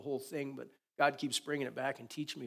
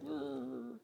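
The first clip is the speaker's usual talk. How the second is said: soft little growl like a confused dog; lightly voiced dog grumble; mock dog growl; 9 dB quieter than usual. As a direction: growl quietly, imitating the dog. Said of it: "The dog's like".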